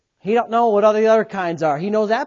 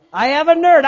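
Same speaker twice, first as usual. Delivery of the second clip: I have a nerd, higher and louder; excited repeat; 7 semitones higher, 8 dB louder than usual. Retold or imitated. To the congregation, excited louder repeat. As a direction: burst out I have a nerd the second time. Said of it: "I said".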